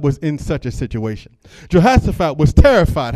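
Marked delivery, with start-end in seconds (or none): 1.7-3.1 louder, driven home; forceful repeat. Acted. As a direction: preach it out loud the second time. Preacher repeats it with more force.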